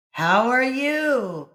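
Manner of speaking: the same call returned from afar; echo. A narrator's reading. Echo returning the call across the valley. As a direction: echo it back slowly. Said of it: "came back from across the valley".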